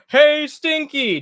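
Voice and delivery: high, slow drawn-out greeting; Grampy voice. Retold or imitated. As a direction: stretch it out like an old grandpa. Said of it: "be like".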